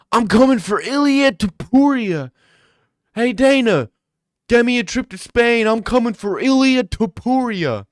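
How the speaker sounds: loud, high hyped mic callout; Sean O'Malley callout. Retold or imitated. Fighter calling out the champ on the mic, loud and high. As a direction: call him out like a fighter on the mic. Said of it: "Sean O'Malley when he K Os".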